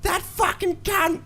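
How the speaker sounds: very high, slow shriek of an angry woman; mock shrieking bogan. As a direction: screech it out in outrage. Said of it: "she goes".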